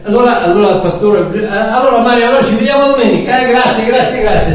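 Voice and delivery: high, quick cheery farewell; lightly voiced pastor saying goodbye; chirpy goodbye. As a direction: wave them off like a busy pastor.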